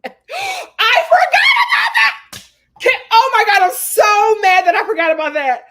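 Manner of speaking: high laughing shout of sudden recall; real gasp then a loud laughing cry; overjoyed yell with laughter; excited shriek. Real, not acted. Reacts to remembered recoupling.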